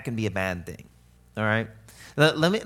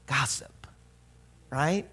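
hushed; whispered; slower than usual. Acted. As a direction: breathe the word gossip like a secret. Naming gossip dramatically.

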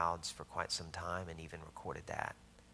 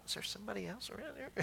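much higher, fast, disbelieving; Gideon's bewildered squeak; 13 semitones higher, 6 dB quieter, faster than usual. Retold or imitated. Gideon reacting to the angel, high comic squeak. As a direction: squeak it out like a startled nobody. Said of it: "Gideon had to go".

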